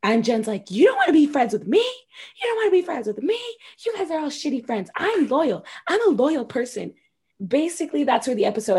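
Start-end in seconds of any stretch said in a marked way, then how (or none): 0.7-1.8 "wanna" lifted high and louder, rising at the end; first indignant repeat, pitched up; mock indignation. Jen repeating the words in disbelief. 1.8-3.4 "wanna" pitched very high, rising sharply; second repeat pushed even higher; mock outrage. Jen repeating the words in disbelief. 3.8-6.9 higher, huffy self-defense; mock defensive whine. Jen defending herself to the group.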